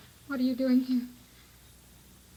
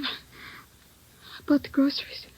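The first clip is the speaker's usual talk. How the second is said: whispered; 9 dB quieter, slower than usual. Acted.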